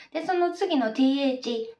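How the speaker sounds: normal talking voice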